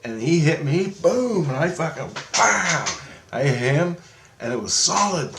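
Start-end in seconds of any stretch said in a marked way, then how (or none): 0.9-1.5 high, drawn-out impact noise; punch sound effect. Retold or imitated. Storyteller showing the hit he took, high punch effect. Acts out the opponent's punch landing. 2.4-2.9 high-pitched imitated impact; punch sound effect. Storyteller showing his punch hitting the man, high impact noise.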